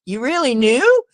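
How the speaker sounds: a hint of a wonderstruck kid; amazed little kid. Kid marveling at the radio, lightly voiced awe. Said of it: "You know, as a kid".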